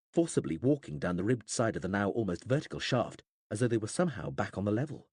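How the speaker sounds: plain reading voice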